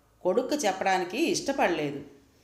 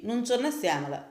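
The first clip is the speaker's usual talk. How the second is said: ordinary delivery, nothing marked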